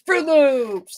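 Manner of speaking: imitating a delighted child's Fruit Loops; excited kid. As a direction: squeal Fruit Loops like a kid naming a favorite cereal. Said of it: "They get excited".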